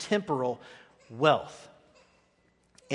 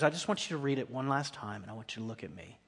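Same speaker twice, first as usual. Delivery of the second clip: quiet plea; 6 semitones lower, 9 dB quieter, faster than usual. Retold or imitated. Minister's quiet final plea.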